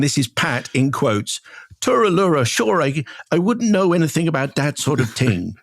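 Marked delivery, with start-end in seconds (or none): 1.8-5.5 jokey stage Irish, pitched higher; hammed-up Irish accent. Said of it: "I think that's the way Pat does it".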